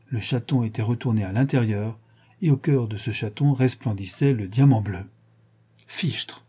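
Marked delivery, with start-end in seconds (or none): 5.9-6.4 softer, stunned murmur; murmured astonishment. A narrator's reading. Inspector stunned at finding the diamond, murmuring. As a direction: let the oath slip out under the breath. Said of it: "murmura Ganimard".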